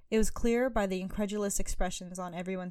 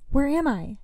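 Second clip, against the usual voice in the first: frantic cry, higher and louder; panicked question, near a shout; lost in a forest, a frantic plea; 7 semitones higher, 7 dB louder than usual. A narrator's reading. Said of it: "asked Arya frantically".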